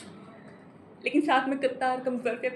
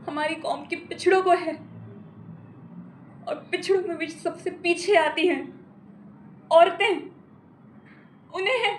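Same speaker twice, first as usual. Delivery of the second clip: impassioned plea; 5 semitones higher, 4 dB louder than usual. Acted. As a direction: voice rising higher and louder to a peak. Impassioned plea to judges for women.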